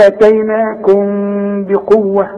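nasal hum linking آتيناكم to بقوة, lips barely meeting; ikhfa. Shown as a demonstration. Teacher showing meem before ba, nasalised ikhfa in آتيناكم بقوة. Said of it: "يحصل لها إخفاء".

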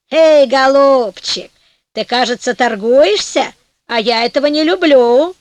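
Death warning Francesco she won't bargain; menacing Death. A narrator's reading.

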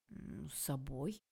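hammed-up faint despair; dying swan tone. A narrator's reading. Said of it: "тоном умирающего лебедя".

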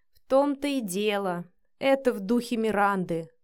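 plain reading voice

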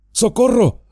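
desperate high shout; cry for help. Acted. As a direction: yell for help in panic. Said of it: "gritó Frodo".

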